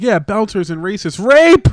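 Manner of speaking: high, slow build to a huge shout; mock yell. Mocking the co-host's story turn, mock yell. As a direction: yell the last word out of nowhere.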